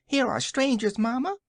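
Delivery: put-on high, shrill childish cry. A narrator's reading. Unseen child tells Mamma of strangers, shrill and childish. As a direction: call out to mother like a little child. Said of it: "shrill and childish voice".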